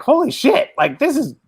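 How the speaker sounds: high, thrilled exclamation; excited outburst. Retold or imitated. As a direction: blurt it out in amazement. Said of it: "and you're like".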